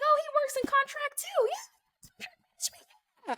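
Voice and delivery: very high fading imitation of the driver; hammed-up driver selling his son; mock eager driver. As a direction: squeak it like a pushy matchmaker. Voicing the driver pitching son.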